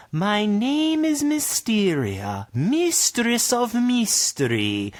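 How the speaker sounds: slow, high mysterious sorceress. Acted. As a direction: draw it out like an enchantress.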